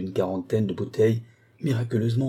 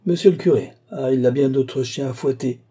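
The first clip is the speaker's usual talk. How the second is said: loud, higher incredulous exclamation; scoffing echo; 5 semitones higher, 5 dB louder than usual. Acted. Abbé hearing she wants the curé, scoffing. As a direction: brush the request off as absurd.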